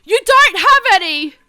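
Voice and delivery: loud high outburst; triumphant retort. Acted. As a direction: shout it back at him. Ebony retorts in scene.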